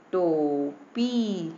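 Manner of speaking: टोपी with both syllables held long; very slow, stretched टोपी; drawn-out word reading. Shown as a demonstration. Teacher reads a spelled word to children, drawn out.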